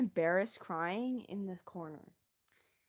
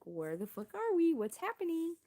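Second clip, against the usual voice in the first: mock panic; 9 semitones higher than usual. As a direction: pitch climbing into a retold panic. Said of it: "I'm just sitting here panicking".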